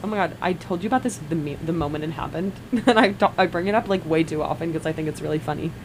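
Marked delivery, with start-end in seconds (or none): none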